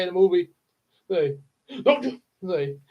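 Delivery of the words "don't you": angry dad. Shown as a demonstration. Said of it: "especially when he got mad".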